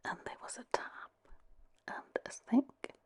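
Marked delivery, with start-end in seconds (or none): none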